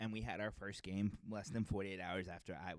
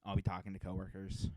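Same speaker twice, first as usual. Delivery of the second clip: usual speaking voice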